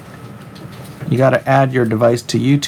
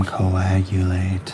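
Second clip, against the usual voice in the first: "coagulate" very slowly, syllable by syllable; sounding it out; 5 semitones lower, 4 dB quieter, much slower than usual. Shown as a demonstration. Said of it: "can speedball coagulate?".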